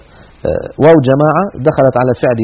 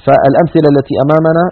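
normal talking voice

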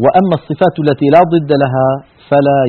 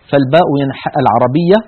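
normal talking voice

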